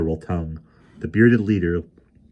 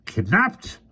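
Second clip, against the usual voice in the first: puzzled leader; 13 semitones higher than usual. A narrator's reading. Leader questions unknown word.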